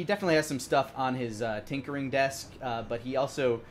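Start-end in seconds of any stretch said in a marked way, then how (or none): none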